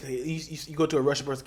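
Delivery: ordinary talk, nothing marked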